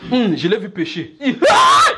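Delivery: rising into a very high, loud cry with laughter; the busybody who can't believe what she saw; hammed-up shocked shriek; mock shocked gossip. Gossip exclaims seeing a sin.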